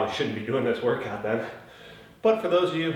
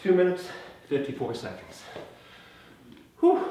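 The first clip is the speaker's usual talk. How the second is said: out of breath; 4 semitones higher than usual. Real, not acted. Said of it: "I'm still a little bit out of breath".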